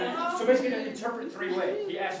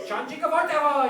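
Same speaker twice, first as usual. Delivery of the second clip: raised, high-pitched call; yelling from afar; 7 semitones higher, 7 dB louder than usual. Retold or imitated. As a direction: imitate a holler from down the road. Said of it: "they're yelling, like, a mile away".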